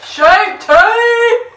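shouted brand name; loud. Real, not acted. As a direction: blurt it out loud.

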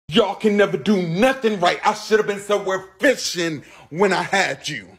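fed-up parent yelling; angry. Acted. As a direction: yell it, fed up. Parent snapping at kids.

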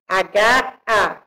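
ordinary talk, nothing marked

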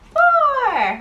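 squealed high note; high sing-song. Acted. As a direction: hit a high playful peak. Counting game with toddler.

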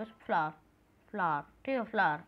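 फ्लावर low and short as "flaa", no r; correct way. Shown as a demonstration. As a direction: drop the r in फ्लावर and keep it low. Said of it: "ई आर नहीं लगना चाहिए".

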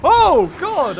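sudden high loud yelp; startled. Real, not acted. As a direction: jump at the fright. Startled by an attack.

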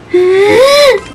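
long, high gasp; frightened gasp. Real, not acted. Player startled by the monster, frightened gasp. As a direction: suck in air in fright.

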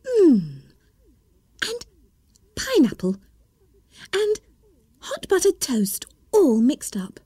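Alice, breathless with delight, whispering; excited little girl whispering. Acted. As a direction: whisper each tasty flavour in wonder. Alice savours the drink.

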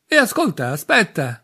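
chiamata acuta e forte dall'alto; gridando. A narrator's reading. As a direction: grida per fermarlo mentre scende. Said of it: "gridò dal secondo piano". (gridando dal piano di sopra)

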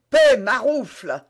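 slow, forceful cry; shouted order. A narrator's reading. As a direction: shout back at a servant. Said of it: "s'écria le voyageur".

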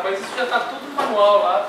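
ordinary talk

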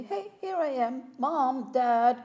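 put-on a child pleading to be seen; calling out as a child. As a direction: call out to be noticed, like a little girl. Child calling for parents' attention.

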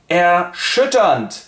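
drawn out slowly in mock outrage; scandalized. Two offended ladies huff at the men, slowly and indignant. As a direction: stretch it like an offended lady.

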